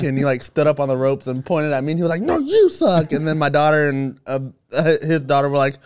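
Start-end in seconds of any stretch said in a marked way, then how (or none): 2.2-3.1 high, loud mock comeback yell; yelled retort. Imitating wrestler on the ropes yelling back at heckler. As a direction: throw the heckle right back, pitched high.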